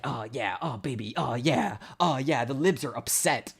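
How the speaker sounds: hammed-up moans of gratification; mock ecstatic, breaking into groaned words; mock pleasure moaning. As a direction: parody someone getting off on it. Mimics conservatives getting off on upset.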